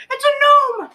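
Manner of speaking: loud, high gleeful cry; excited shout. Real, not acted. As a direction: blurt it out thrilled. Excited player calling out.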